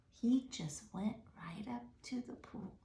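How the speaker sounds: soft, breathy and hushed; suspense whisper. A narrator's reading. Reader whispering the suspense to the children. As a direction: keep it secret and tense.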